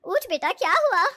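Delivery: loud, urgent mother waking her daughter; worried mother. Acted. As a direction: call out to wake her, rising in concern. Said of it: "माँ मुझे जगा रही थी". (घबराई माँ की जगाती हुई आवाज़)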